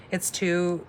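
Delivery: ordinary talk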